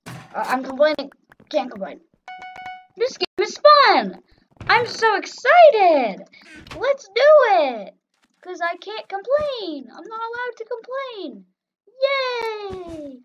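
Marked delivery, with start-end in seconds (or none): 3.0-13.2 high, put-on cheer; sarcastic fake enthusiasm. Player forbidden to complain about the game, sarcastic fake enthusiasm. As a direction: gush far too brightly, voice pitched way up. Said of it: "I was being sarcastic".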